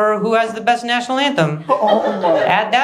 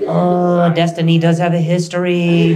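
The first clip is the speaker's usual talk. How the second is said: lightly voiced disappointed commentator; a hint of a glum gymnastics announcer; deflated sports announcer. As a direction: sound mildly let down at every routine. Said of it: "they always have this tone".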